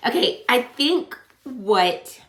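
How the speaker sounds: very slow, tearful restart; teary, halting start; crying. Real, not acted. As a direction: try again through tears, voice wavering. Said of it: "I am crying".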